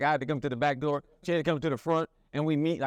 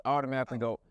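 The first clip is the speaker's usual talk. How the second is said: ordinary talk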